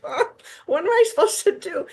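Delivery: high-pitched, squeaky retold bewilderment; mock panic. New student left alone at the radio station, mock panic. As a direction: voice leaps way up in mock helplessness.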